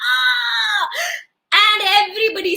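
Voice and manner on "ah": high, drawn-out "aah"; acted straining yell; effortful cry. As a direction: cry out as if lifting off a great weight. King removing heavy crown.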